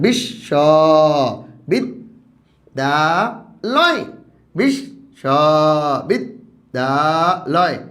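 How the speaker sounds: বিশ্ববিদ্যালয় stretched very slowly, syllable by syllable; slow counting voice for the class; drawn-out syllables. Shown as a demonstration. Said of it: "পাঁচটি।".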